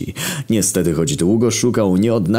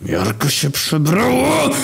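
higher, slow snarl of anger; angry growl; 10 semitones higher, slower than usual. Acted. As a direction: growl it out, fed up. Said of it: "warknął zdenerwowany". (gniewne warknięcie)

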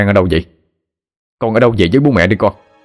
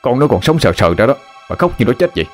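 irritated snapping; 2 semitones higher than usual. Acted. Husband scolding his crying wife, irritated snap. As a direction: tell her off for making a fuss. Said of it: "Ông gắt lên".

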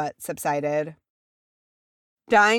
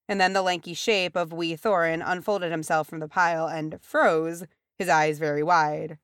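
ordinary delivery

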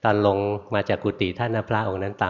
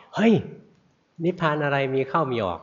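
higher-pitched retold rebuke held over the whole line; louder scolding; 5 semitones higher than usual. Monk scolds the student harder the second time, mock shout. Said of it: "คราวนี้ตวาดดังกว่าเก่า".

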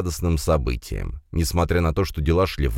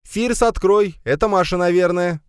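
high, raised motherly voice; loud call; 4 dB louder than usual. A narrator's reading. Mother tells Firs to open door, calling loudly. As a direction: call out to your son to get the door. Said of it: "громко произнесла женщина".

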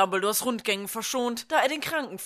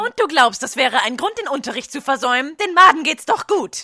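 higher and louder, scornful; scolding; 4 semitones higher, 7 dB louder than usual. Acted. Nurse scolding the boy for skipping class, loud. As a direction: wave his illness away loudly.

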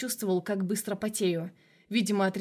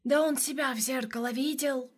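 higher, mocking sinister voice; indignant shadow voice; 5 semitones higher than usual. A narrator's reading. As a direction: sneer like an offended demon. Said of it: "возмутилась тень".

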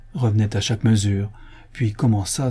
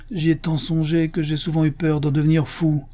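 a lightly voiced old Corsican father, firm and grave; old man laying down his oath; stern old father; 7 semitones higher than usual. A narrator's reading. A hint of a grave old father refusing the young suitor.

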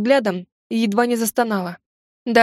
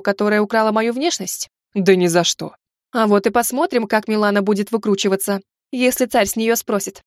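usual speaking voice

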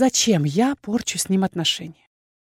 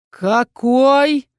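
louder, higher angry hiss; 5 semitones higher, 6 dB louder than usual. A narrator's reading. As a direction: spit the word back in offence. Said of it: "прошипел инквизитор".

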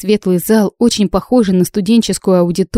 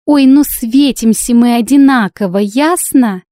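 high, loud eye-rolling retort; theatrical exasperation; 5 semitones higher, 5 dB louder than usual. A narrator's reading. Bride explaining to slow judges, theatrically exasperated. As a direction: spell it out loudly, pitched up. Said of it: "Я картинно закатила глаза".

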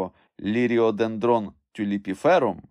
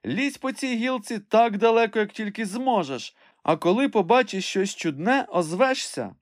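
high, excited calling out; excited shout; 12 semitones higher than usual. A narrator's reading. Master urges climber out along the branch, excited call. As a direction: urge them on from below, voice raised. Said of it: "збуджено закричав Легран".

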